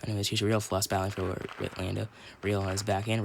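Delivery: usual speaking voice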